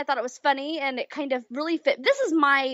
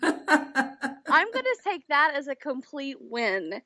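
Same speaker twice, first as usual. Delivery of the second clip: slow, laughing through it; 3 semitones higher, much slower than usual. Real, not acted. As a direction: say it laughing, pleased. Laughs at the compliment.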